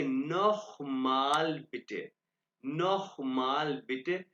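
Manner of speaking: नोखमाल said slowly with a throaty kh; German ch sound. Shown as a demonstration. Teacher drilling the class on नोखमाल, slow throaty kh. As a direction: drag out नोखमाल with the ch as a rasping kh. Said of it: "ये C H जहां भी आएगा खे की आवाज निकालेगा".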